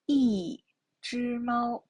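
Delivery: slow "一只猫" with "一" falling; "一只猫", falling first syllable drawn out; fourth-tone model. Shown as a demonstration. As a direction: drop the pitch on "一", then hold "猫" level. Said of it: "is the fourth tone".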